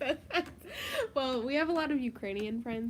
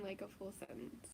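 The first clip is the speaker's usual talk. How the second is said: whisper; 5 semitones lower, 16 dB quieter than usual. Real, not acted. Whispered aside.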